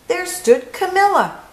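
plain reading voice

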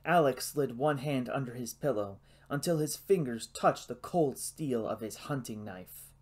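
ordinary delivery

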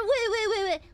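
high mocking wail; mock crying. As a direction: imitate a bawling crybaby. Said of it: "Always crying".

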